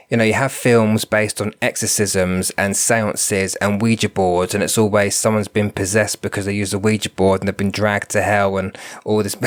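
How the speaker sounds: normal talking voice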